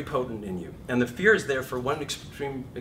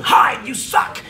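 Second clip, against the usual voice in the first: higher, louder slow jeer; fear voice sneering; 12 semitones higher, 14 dB louder, slower than usual. Acted. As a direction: sneer it down at yourself. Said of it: "the fear voice is going".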